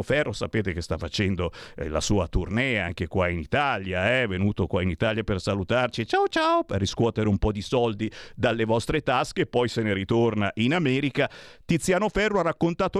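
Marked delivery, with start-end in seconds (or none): 6.0-6.6 sing-song ciao ciao pitched way up; high, teasing farewell; mocking goodbye. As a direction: wave him off with a sarcastic chirp. Mocking Ferro's farewell to Italy.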